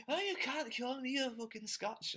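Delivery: high, indignant mock Scottish protest; mock offended Scot. Host mocks an offended Scot over being called Scotch, high mock outrage. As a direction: squeal it like an outraged Scot objecting to the name.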